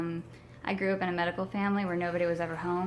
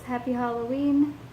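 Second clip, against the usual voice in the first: lightly put-on rock star voice; a hint of a rock singer's greeting; 5 semitones higher, 5 dB louder than usual.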